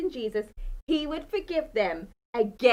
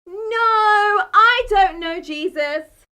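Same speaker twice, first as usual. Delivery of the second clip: loud, higher, panicked no; loud denial; 6 semitones higher, 10 dB louder, much slower than usual. A narrator's reading. Peter denying Jesus by the fire, loud panicky denial. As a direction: push the denial out hard.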